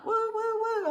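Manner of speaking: high, hooting woo at the cold; mock shiver woo. Real, not acted. Host reacting to viewer's freezing night, high hooting woo. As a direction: hoot about the cold.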